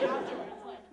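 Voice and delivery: ordinary talk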